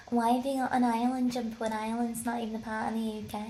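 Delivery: mock British tourist accent; snarky British tourist. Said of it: "I did it in that accent".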